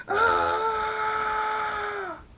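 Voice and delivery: long, very high, loud burning scream; agonized scream. Acted. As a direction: scream as the fire takes you. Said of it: "He screams".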